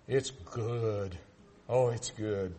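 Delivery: low, soft relishing; savoring. Real, not acted. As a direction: savor the memory of the taste. Savoring remembered quail.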